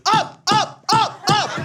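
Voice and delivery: very high-pitched imitation of girlfriend; mock high yelp. Girlfriend calling him up from down there, mock high yelps.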